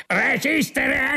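high, long-drawn yell; bellowed slogan. A narrator's reading. Guard showing off the yelling he enjoys, drawn-out shout. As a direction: fill your lungs and holler it slowly. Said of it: "Si riempì i polmoni e gridò".

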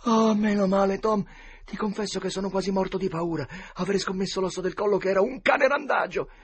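put-on high, loud, excited boyish chatter; frightened boys, relieved. A narrator's reading. Boys recognise the neighbour's dog, relieved and excited. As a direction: gush with relief after a bad scare.

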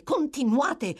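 impatient matron pressing the dying woman; urgent urging. Acted. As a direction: press her harder to go on.

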